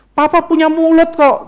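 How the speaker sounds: high-pitched sulky comeback; angry child. Retold or imitated. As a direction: play a kid answering back. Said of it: "satu anak yang marah-marah". (tiruan anak membantah, nada tinggi)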